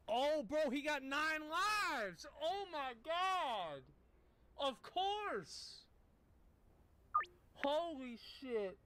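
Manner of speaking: high, drawn-out exaggerated realization; mock amazement. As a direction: stretch it out high, like a huge revelation. Mock awe at working out nails.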